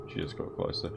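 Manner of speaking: hushed, uneasy whisper. Real, not acted. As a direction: breathe it out, spooked. Creeped out by approaching nun.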